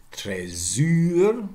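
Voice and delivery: TRÉSOR as "tray-ZÜR", stressed on the end; Trésor drawn out on a rounded French vowel; French pronunciation. Shown as a demonstration. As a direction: say Trésor the French way, high and loud. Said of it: "something like this in French".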